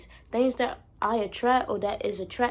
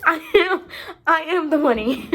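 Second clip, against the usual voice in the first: loud, high, laughing through the words; laughing proclamation; 5 semitones higher, 8 dB louder than usual. Real, not acted. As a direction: crow it out between laughs.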